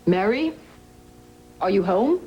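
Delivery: calling out to the house. Shown as a demonstration. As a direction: call out for her from the door. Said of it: "He's calling out".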